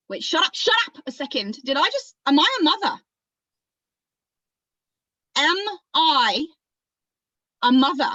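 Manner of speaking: stunned, urgent questioning, high and loud; shocked realization. Acted. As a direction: break it up with pauses as the shock lands. Acted shock at own words.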